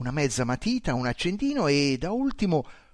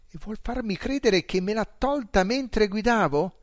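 higher, disbelieving question; stupefied question; 8 semitones higher, 5 dB louder than usual. A narrator's reading. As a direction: ask as if it can't be true. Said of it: "domandai stupefatto".

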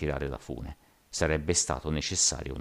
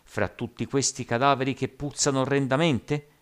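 high-pitched outcry without much volume; mild narrated cry; 7 semitones higher than usual. A narrator's reading. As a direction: cry out in protest, only mildly. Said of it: "gridò un altro".

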